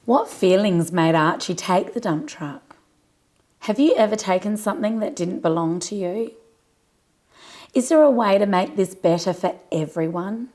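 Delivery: plain reading voice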